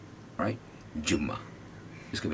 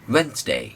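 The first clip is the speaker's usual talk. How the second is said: Wednesday higher, WENS lifted; stress on WENS; 4 semitones higher, 5 dB louder, much slower than usual. Shown as a demonstration. Teacher models Wednesday stressed. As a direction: hit veins. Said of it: "अब तीन बार कहेंगे".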